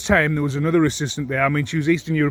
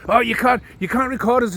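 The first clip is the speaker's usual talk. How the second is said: mocking the assistant; 9 semitones higher, 3 dB louder than usual. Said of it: "this female's like".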